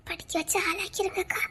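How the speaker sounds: very soft, under the breath; hushed farewell. Acted. Asking elder sister to raise the children, hushed. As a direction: plead quietly, keeping it secret.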